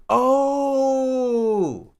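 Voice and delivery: loud delighted gasp; excited shout. Real, not acted. Figures out the fix.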